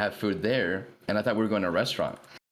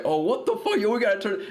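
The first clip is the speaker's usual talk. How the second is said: alarmed; 9 semitones higher than usual. Retold or imitated. Alarmed after a stabbing threat.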